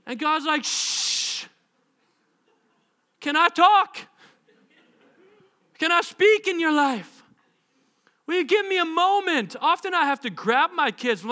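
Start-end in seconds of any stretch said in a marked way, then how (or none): 0.6-1.5 drawn-out unvoiced shh; long shush. God interrupts the believer's questions with a long shush. 3.2-9.6 high, loud, pointed questions; exasperated interjection. Acted. God asks chattering believer for a moment, exasperated.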